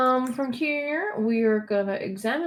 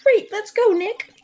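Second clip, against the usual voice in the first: high, loud and eager; high-pitched girl voice; 12 semitones higher, 7 dB louder than usual. Acted. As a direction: urge him on like a keen teen. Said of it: "stop with the high-pitched voice".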